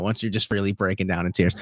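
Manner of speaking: usual speaking voice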